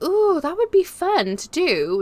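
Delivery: high, drawn-out retold delight; excited idea. Recalling her bright idea to the chat, excited. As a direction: coo it high and slow as a fun idea just struck.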